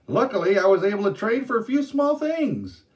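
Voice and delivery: high, loud teasing announcement; proud storyteller. Acted. Day Day announcing a trade to curious girls, playful. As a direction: tease them with good news.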